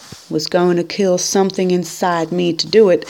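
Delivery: plain reading voice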